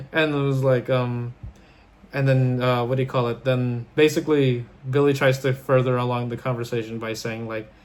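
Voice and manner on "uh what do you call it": usual speaking voice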